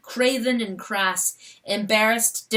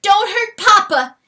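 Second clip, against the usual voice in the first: put-on high loud child's plea; cried; 12 semitones higher, 9 dB louder than usual. Fawn defending her father, high cry. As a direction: fawn crying out, much higher. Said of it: "she cried".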